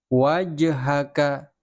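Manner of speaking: "Wajhaka" slow, the j bouncing into a light vowel, "waja-haka"; bouncing jim. Shown as a demonstration. Teacher models the bouncing jim for pupils, slow. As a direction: let the j in "Wajhaka" rebound. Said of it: "Bukan wajhaka".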